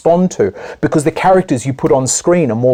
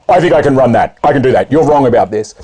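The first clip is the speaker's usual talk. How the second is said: louder, blunt interrupting man; confident man blurting in; 4 dB louder than usual. Retold or imitated. Man in a meeting blurting out his opinion, loud and sure. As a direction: barge in and claim the floor. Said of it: "Men are really good at just putting their hand up".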